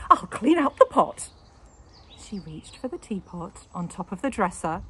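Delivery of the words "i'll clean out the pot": high, loud eager voice; delighted wife. A narrator's reading. Wife eager to make tea, high and loud. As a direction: bustle off eagerly. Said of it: "wife delighted".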